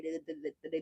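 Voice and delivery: real stutter, soft and low; stumbling over the word. Real, not acted. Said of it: "as I'm stuttering".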